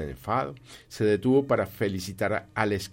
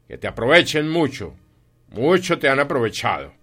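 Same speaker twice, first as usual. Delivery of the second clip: loud scorn, pitched up; scornful; 5 semitones higher, 8 dB louder than usual. Acted. Scrooge mocks his nephew's love of Christmas, loud and scornful. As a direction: throw it at him as a loud jibe.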